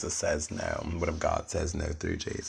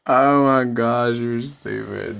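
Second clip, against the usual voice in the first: slow drawn-out tease; 4 semitones higher, 8 dB louder, slower than usual. Real, not acted. Slow laughing disbelief.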